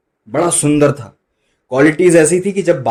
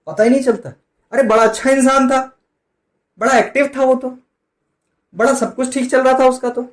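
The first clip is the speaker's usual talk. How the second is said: lamenting mourners; 11 semitones higher than usual. Retold or imitated. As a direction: imitate people lamenting a sudden death in a high pitch. Voicing mourners lamenting a sudden death. (शोक करते लोगों की ऊँची आवाज़ में नकल)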